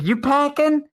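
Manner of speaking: high-pitched, pushy mock question; imitated confrontational stranger. Stranger repeating himself to the friend, high imitated challenge.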